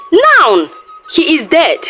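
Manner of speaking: plain reading voice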